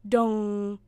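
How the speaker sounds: long, loud monotone dong; clock chime. A narrator's reading. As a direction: ring out like a striking clock. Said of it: "called the clock".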